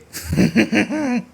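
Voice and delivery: high run of short chuckles; giggling. Real, not acted. Host after saying sorry, giggling on. Laughing again after apologising.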